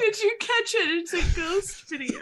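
high, laughing quote. Real, not acted. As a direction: giggle out the question. Laughing, quoting video.

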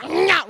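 high, quick chomping noise; imitated biting snarl. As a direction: mimic a toddler lunging to bite. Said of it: "he would".

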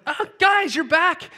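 welcoming the hecklers with glee; delighted greeting. Retold or imitated. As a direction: greet them like long-lost friends.

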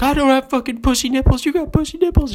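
very high, loud tearful protest; hammed-up crying outburst; mock crying yell. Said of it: "he's crying while he's doing it".

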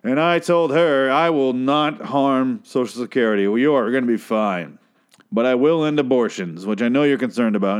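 slow, measured stump-speech delivery; politician. Acted. Politician telling a made-up story of a worried mother, slow oratory. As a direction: spin a campaign anecdote, weighing every phrase. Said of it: "sounds like a politician made up story".

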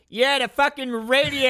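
high, loud angry yelling; loud rough shout. Acted. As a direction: bark it out over the noise. Acted crew member shouting complaints.

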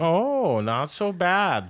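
slow, high, loud Minnesotan drawl; Minnesota accent. Pretend. Host answering his guest in mock Minnesota accent. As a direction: stretch it out like a Minnesota fisherman. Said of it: "where did you get that accent from".

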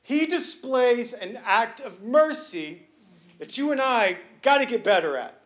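full-force preaching. Acted. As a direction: preach it, building to the crowd's amens.

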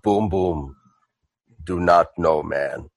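very low, soft, halting brute; Boom Boom. Acted. Boom Boom in a moment of clarity, low and halting. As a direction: say it deep, word group by word group. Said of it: "has a moment of clarity and goes".

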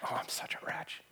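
hushed, whispered mock lament; whispered self-reproach. Preacher voicing a sinner's inner verdict on himself, whispered self-reproach.

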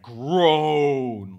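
a long, loud, frustrated groan. Shown as a demonstration. Elephant sick of waiting, loud groan. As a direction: groan like a bored kid. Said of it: "like that".

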